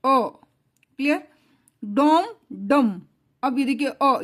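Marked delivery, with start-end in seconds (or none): 1.8-3.0 slow, drawn-out डॉम, डम from the throat; throat sound demo. Shown as a demonstration. Teacher slowly demonstrating a throat sound to students. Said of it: "गले से sound आती है".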